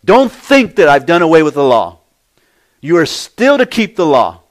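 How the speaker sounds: firm, forceful warning that allows no doubt; emphatic. Acted. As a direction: drive each point home forcefully. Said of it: "Jesus was being emphatic here".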